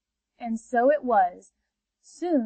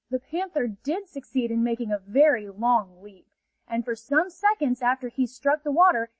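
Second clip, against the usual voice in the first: plain reading voice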